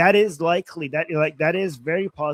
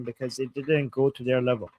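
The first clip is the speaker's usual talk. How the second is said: normal talking voice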